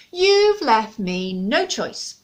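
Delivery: loud, very slow final warning; grave owl. Acted. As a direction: deliver the last warning gravely.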